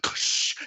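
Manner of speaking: imitated "kssh" whoosh; hissed sound effect of a Jedi push; mouth-made blast noise; force push noise.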